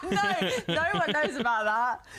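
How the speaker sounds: high-pitched, laughing through the words. Real, not acted. Reacting to being teased about the language, laughing. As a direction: crack up with embarrassment.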